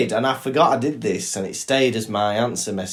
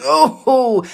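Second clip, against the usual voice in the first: mock delighted old lady, much higher on the oh; Doreen impression; 6 semitones higher, 5 dB louder, much slower than usual. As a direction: coo at the grandson on the phone. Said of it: "She goes".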